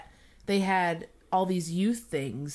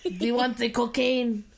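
hammed-up Russian movie drug lord; badly faked Russian accent that slips towards French; slower than usual. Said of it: "like, some Russian".

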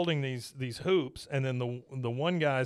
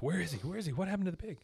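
worried murmuring crowd; 5 semitones higher, 6 dB quieter, much faster than usual. Retold or imitated.

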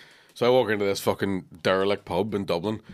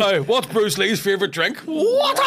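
slow setup then a high laughing yell; laughing shout; 11 semitones higher, 6 dB louder, slower than usual. Pretend. Comic yelling a joke punchline at his mate.

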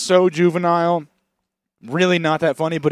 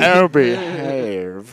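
slow, laughing impression; laughing movie quote; 5 dB louder, much slower than usual. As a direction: chuckle the catchphrase slowly. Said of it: "Austin quote".

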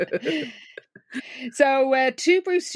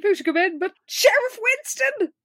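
higher-pitched grand introduction; mock dramatic reveal; 9 semitones higher than usual. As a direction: announce the surprise arrival with a flourish.

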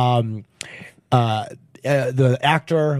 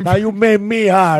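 put-on Italian accent; 5 semitones higher, 4 dB louder than usual. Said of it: "in an Italian accent".

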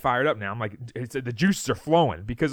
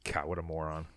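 much softer and lower under-breath scoff; muttered insult; 9 semitones lower, 11 dB quieter than usual. Real, not acted. Host to cohost, muttering in disgust at the coach's answer. Host reacts to press conference clip.

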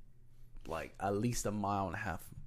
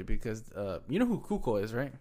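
ordinary delivery

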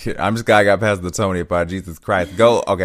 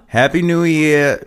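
tag-less; 6 semitones higher, 5 dB louder than usual. Acted. Rehearsing a character's greeting.

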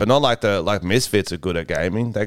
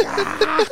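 high squealing mock scream, half laughing; excited yelp. Mimicking going nuts for the camera, high squeal. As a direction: let out a high giddy squeal.

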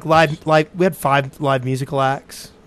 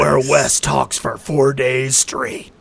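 slow, drawn-out trailer narration; movie trailer voice; much slower than usual. Acted. Teasing about talking all weekend, slow movie-trailer voice. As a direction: stretch it out like a film trailer. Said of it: "You do the movie voice?".

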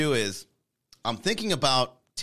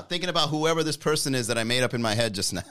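ordinary talk, nothing marked

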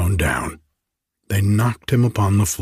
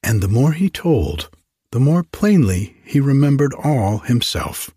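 ordinary delivery

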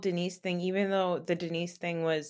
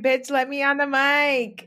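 higher, louder demanding quote; sassy mic-hungry dog; 6 semitones higher, 6 dB louder than usual. Retold or imitated. Host playfully voices Harold demanding the mic, sassy and pushy. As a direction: voice a pushy little attention seeker. Voicing Harold wanting the microphone.

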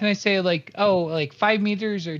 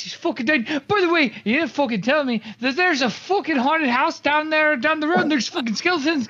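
freaked-out rant; 8 semitones higher than usual. Acted. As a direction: blurt it out in a frantic panic. Panicked in-character rant to barkeep.

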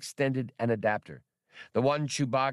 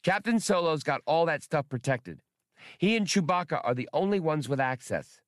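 high, eager little droid voice; childish droid voice; 6 semitones higher than usual. Acted. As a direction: chirp it like a know-it-all kid robot. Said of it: "in his childish voice".

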